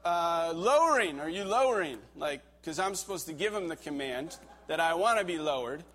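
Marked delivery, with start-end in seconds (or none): 0.5-2.0 raised, higher call up the ice wall; yelling up. Retold or imitated. Belayer Kevin calling up to the climber, a raised shout. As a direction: call it up to someone far above. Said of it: "so Kevin yells up".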